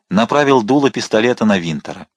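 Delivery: plain reading voice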